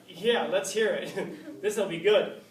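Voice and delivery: slow, loud, laughing sarcasm; mocking the defendant with a laugh; sarcastic jury. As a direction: scoff at him like a skeptical juror. Jury mocking Socrates' second plan.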